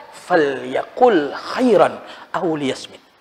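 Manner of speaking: slow, soft Arabic recitation; measured quoted Arabic; hadith recitation. Acted. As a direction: recite the hadith slowly and reverently.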